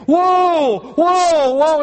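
very high, urgent cry to halt; panicked shout. Acted. Rider heading for a cliff, shouting in panic. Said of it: "the man in panic is saying".

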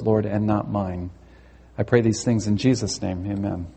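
low, subdued praying voice; prayer. Real, not acted. Preacher praying before the message, low and reverent.

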